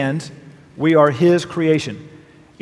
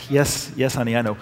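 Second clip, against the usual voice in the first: softer, lower, half-laughing aside; quiet aside to wife; 6 semitones lower, 6 dB quieter than usual. Real, not acted. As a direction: concede softly to your wife. Aside to wife about driving.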